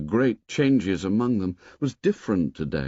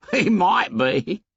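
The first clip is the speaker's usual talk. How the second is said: laughing; 10 semitones higher, 4 dB louder, slower than usual. A narrator's reading. Piggy laughing, slow.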